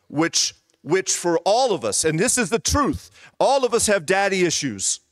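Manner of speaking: pitched well up, building to a peak; preacher's climactic lift; rising preaching fervor. Real, not acted.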